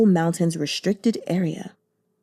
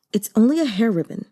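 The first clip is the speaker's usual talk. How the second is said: ordinary delivery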